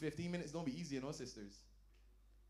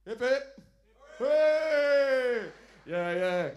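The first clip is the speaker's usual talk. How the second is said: loud, high cheering call; rally the crowd with a big shout; 7 semitones higher, 11 dB louder, much slower than usual. Acted. Comic leading a cheer for men who last, loud cheer.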